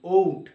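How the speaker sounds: ওউট said loudly as o then u; pron demo. Shown as a demonstration. Teacher showing learners the vowel glide: loud ওউট. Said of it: "উট করে দিই".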